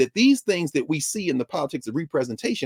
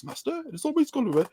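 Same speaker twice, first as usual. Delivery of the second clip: servile droid butler, pitched up; 10 semitones higher, 3 dB quieter than usual. Retold or imitated. Mocking the Star Wars droid as slave butler, pitched-up servile voice. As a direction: mimic the fawning robot servant. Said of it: "the droid that speaks like this".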